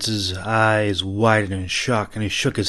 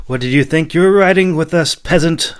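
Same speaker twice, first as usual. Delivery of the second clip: mocking question; 8 semitones higher, 8 dB louder than usual. A narrator's reading. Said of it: "Gruen asked".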